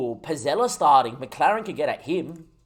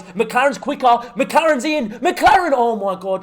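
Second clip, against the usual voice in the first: building from higher and louder to a near shout; rising excitement; 7 semitones higher, 5 dB louder, slower than usual. Real, not acted. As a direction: climb with the run towards goal.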